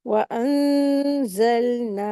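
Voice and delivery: "वअंज़लना" very slow, long nasal hum, still l; correct recitation. Shown as a demonstration. Teacher showing the right reading, slow ghunna and steady laam. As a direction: stretch "वअंज़लना", resting on the l without bouncing. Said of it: "ध्यान में रखते हुए अच्छे से पढ़ेंगे".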